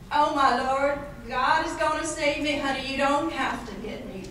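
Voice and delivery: lightly played panicked Southern lady; Southern accent. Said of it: "she does the sketch".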